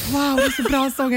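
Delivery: lightly gushing imitation of a friend; mock gushing Shirley. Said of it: "Du bara, du bara". (retar väninnan med lätt överdrivet förtjust röst)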